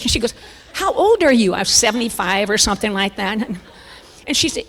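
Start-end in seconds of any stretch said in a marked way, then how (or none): 0.7-1.5 higher, louder incredulous question; mock astonishment. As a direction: imitate a nurse who can't believe it. Astonished nurse asks in retold scene.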